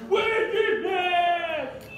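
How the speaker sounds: high, long-drawn mock shout; yelling. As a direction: bellow it slowly as a scolding stranger. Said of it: "and yelled at him".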